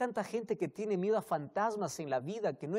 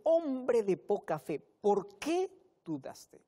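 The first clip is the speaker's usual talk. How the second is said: higher, louder and slow, "Hombre" hit hard; a firm preacher's reproach; Jesus sternly rebuking Peter's doubt; stern rebuke; 5 semitones higher, 5 dB louder, slower than usual. Retold or imitated. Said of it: "Jesús le dice".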